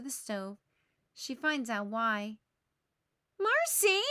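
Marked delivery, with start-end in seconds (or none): 3.4-4.0 loud high call of her friend's name; crying out. Acted. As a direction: shout Marcy across the kitchen. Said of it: "she cries".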